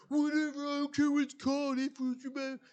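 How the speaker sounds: high whiny careless voice; Morrow brushing it off. Retold or imitated. Employee brushing off the boss, whiny. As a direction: whine it off like a lazy kid. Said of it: "He's like".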